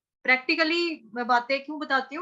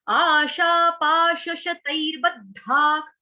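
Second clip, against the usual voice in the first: slow recitation; 3 semitones higher, 4 dB louder, much slower than usual. Shown as a demonstration. Teacher reciting the shloka slowly for students. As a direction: chant आशा पाश शतैर्बद्धाक slowly, syllable by syllable. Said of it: "विसर्ग का उच्चारण हो रहा है ख से".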